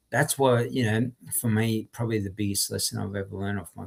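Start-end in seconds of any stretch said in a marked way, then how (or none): none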